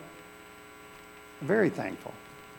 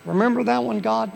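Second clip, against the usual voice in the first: high, pleading reminder; plea to God to remember his promise; reminding God; 6 semitones higher, 4 dB louder than usual. Acted. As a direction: nudge God like an old friend. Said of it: "Remind him".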